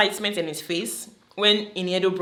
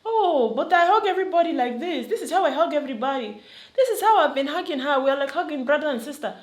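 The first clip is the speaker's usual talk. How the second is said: higher, whiny mock excuse; imitating the guy defending his hugs, higher and mocking; mocking Louis; 8 semitones higher, faster than usual. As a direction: play him as a clueless man-child excusing himself. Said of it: "He comes off as".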